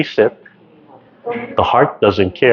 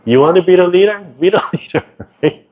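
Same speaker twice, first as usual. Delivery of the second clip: playful challenge; 9 semitones higher, faster than usual. Real, not acted.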